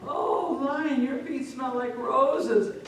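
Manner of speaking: high, drawn-out sweet talk; butter up the lion with an exaggerated compliment; fawning flattery. Cat falsely flatters the lion.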